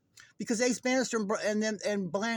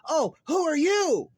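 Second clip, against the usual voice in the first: high, loud, slow fake startled cry; put-on shocked gasp of a question; mock surprise; 10 semitones higher, 8 dB louder, slower than usual. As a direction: play a hammed-up surprised discovery. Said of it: "discover Nick and go".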